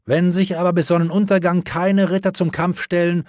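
high, loud arrogant proclamation; haughty herald. Acted. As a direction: deliver the threat with contempt. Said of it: "verkündete der Herold hochmütig".

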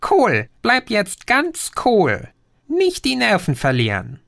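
put-on a cyber gnome calming the boy; tiny green creature. (kleines grünes Wesen, höher, beruhigend)

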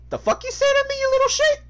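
very high, squealed mock outrage; Ninja impression. Gamer snaps at someone who insulted him, squeaky mock outrage. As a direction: put on a high-pitched indignant streamer rant. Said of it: "ninja came out of me".

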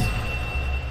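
quick breathy gasp of surprise; a startled little intake of air; soft gasp. Real, not acted. Finds a journal in horror game.

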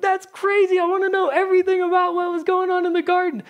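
high, excited and fast, like meeting a celebrity; excited fan. Pretend. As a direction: gush at Adam and Eve like a starstruck fan. Youth imagining meeting Adam, Eve.

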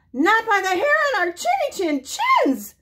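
very high squeaky pig voices; squealing pigs. Acted. Three pigs refuse the wolf, very high squeal. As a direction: squeal it out defiantly. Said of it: "squealed the three little pigs".

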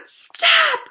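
high, piercing, very loud cry; panicked full-voice shout; screamed. A narrator's reading. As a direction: scream to cut someone off. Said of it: "screamed Wilbur".

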